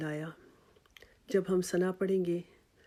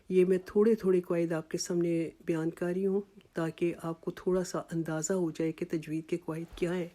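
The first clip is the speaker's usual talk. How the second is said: ordinary talk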